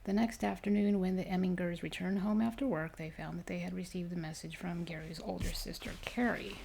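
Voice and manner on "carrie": plain reading voice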